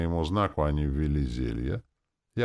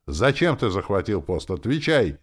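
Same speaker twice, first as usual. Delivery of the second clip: raised, demanding outburst; yelling; 4 semitones higher, 6 dB louder than usual. A narrator's reading. Captain demands an answer about the post, yelling. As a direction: demand an answer at a moderate yell. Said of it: "заорал он".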